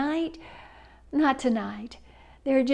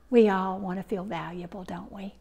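lower, quick confiding whisper; whispered aside; 6 semitones lower, 5 dB quieter than usual. Real, not acted. Preacher confiding to the congregation, lower whisper. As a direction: lean in and share a secret.

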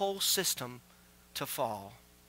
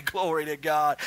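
normal talking voice

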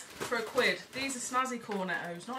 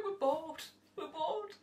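put-on whiny bored kids; 7 semitones higher, slower than usual.

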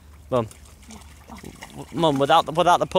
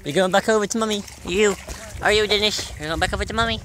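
talking to a puppy; 8 semitones higher, faster than usual. Real, not acted. Owner cooing at the dog, higher baby talk. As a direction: coo at the dog.

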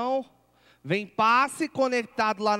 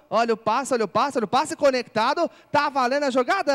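rising, louder play call; excited play-by-play; 4 semitones higher, 5 dB louder than usual. Real, not acted. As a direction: build up the pass call. Play-by-play on a live pass.

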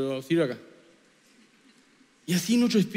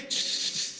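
unvoiced shush; whispered shush to silence; hushing; 10 dB quieter than usual. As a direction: hush it away with a whispered shh. God hushes the spirit.

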